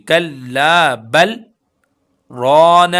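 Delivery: रौन with a heavy रा, loud and drawn. Shown as a demonstration. Teacher models heavy रा for students, loud slow chant. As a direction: chant रौन slowly with a thick रा. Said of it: "रौन ऐसे रॉ को मोटा करेंगे".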